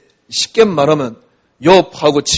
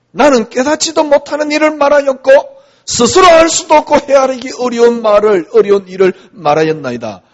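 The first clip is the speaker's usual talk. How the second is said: higher, louder fervent recital; preaching Job's confession loudly; 9 semitones higher, 8 dB louder than usual. Acted. Preacher voicing Job's confession to God, fervent. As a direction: proclaim Job's humble answer with force.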